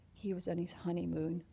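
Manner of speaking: usual speaking voice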